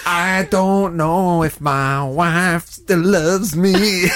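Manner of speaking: slow, drawn-out mock lament; hammed-up impression, stretching every word; Declan. Player imitating his friend doubting his wife, slow mock-plaintive. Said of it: "That's a bit more accurate".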